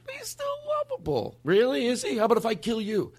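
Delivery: very high sarcastic squeal on lovable; mock sarcasm. Mocking moviegoers who find the killer lovable, high sarcastic squeal. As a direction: scoff at the idea, mockingly. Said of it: "And then go".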